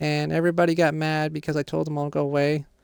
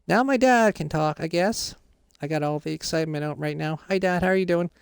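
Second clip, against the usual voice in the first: ordinary delivery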